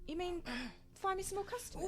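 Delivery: indignant. Acted. Indignant at suggestion.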